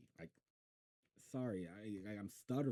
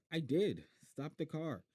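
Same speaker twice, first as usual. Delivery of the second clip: insistent call; 8 semitones higher, 6 dB louder than usual. Acted. Boy insisting, calling out.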